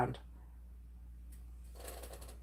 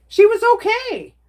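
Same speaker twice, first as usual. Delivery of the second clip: much higher and louder, almost squealed; emphatic repeat; 13 semitones higher, 6 dB louder than usual. Real, not acted. Reassuring her chat her mom made it through, emphatic and high. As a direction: stress it hard the second time, pitch jumping up.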